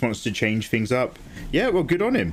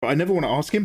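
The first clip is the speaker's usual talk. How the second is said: ordinary talk, nothing marked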